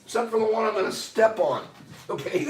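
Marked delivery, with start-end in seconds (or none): none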